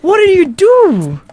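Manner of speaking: drawn-out, high, loud scolding; loud, high-pitched "what have you done"; mock scolding. Real, not acted. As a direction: cry out in dismay, stretching it. Mock scolding over broken bed.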